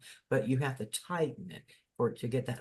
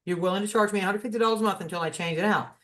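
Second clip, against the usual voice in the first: raised, insistent pitch, quick at the end; retold phone argument, firmly protesting; indignant retort; 6 semitones higher, faster than usual. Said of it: "you don't have to get upset".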